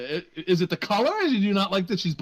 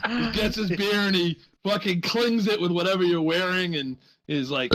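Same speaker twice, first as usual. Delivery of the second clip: normal talking voice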